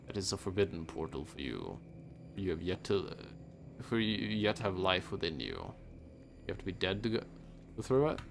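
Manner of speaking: solemn, low and soft grim reaper; a hint of an ancient hooded figure. Voices the Reaper reading.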